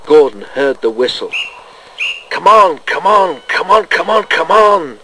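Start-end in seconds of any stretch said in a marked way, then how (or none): 2.3-5.0 loud, high, straining chant; urgent shout. A narrator's reading. Engine straining to pull away, shouting. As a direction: shout it over and over as if heaving a load. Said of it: "he shouted as he tried to pull".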